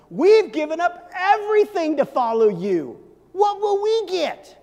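high, loud, slow demanding question; indignant Peter. Acted. Peter asking Jesus what they get, high loud demand. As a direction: Peter pressing for his due. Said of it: "and Peter says to him".

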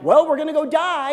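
voiced widow.

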